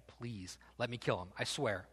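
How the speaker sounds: low, hushed plea; softly pleading, voice dropped; begging. Acted. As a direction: beg under your breath. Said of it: "he's just begging".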